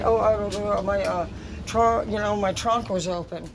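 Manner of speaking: a hint of a sheepish liar; mocking the excuse. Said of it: "They said".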